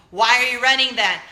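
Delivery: loud, mock-challenging question; mock shouting viewer; mock incredulous shout. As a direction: fire the question back like a heckler. Mock viewers asking loudly.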